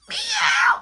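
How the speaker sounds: very high, loud cat meow; mock cat meow. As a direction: squeal it like a teasing cat. Friend mimicking a cat.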